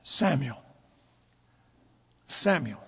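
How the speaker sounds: hushed low whispered call; voice of God. Acted. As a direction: call his name softly from the dark. Voice of God calls again.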